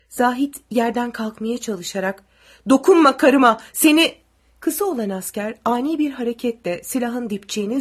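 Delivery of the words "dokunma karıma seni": loud, high angry outburst; raised, furious yell breaking off; furious warning. A narrator's reading. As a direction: shout to protect your wife. Husband defends wife, is cut off.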